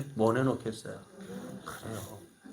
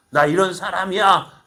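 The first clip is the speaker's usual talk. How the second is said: louder, higher puffed-up boast; swaggering boast; 5 semitones higher, 7 dB louder than usual. Retold or imitated. Colonial policeman boasts to the crowd, puffed-up and louder. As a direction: play the proud colonial policeman showing off. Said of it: "목에 힘을 주고".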